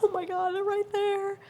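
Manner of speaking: high-pitched awed squeal; reenacted giddy fan; starstruck. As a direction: squeak it out, can't chill. Starstruck, told to chill.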